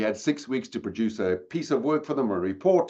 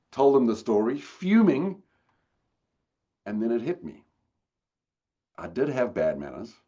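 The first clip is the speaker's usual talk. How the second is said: ordinary talk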